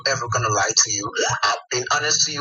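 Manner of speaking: normal talking voice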